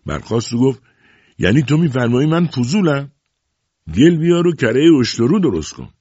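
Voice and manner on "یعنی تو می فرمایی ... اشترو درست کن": high, loud offended retort; stung indignation. A narrator's reading. Nowruz stands up after being called nosy, indignant. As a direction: fire back, affronted, pitch raised. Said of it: "نوروز رنگ به رنگ شد".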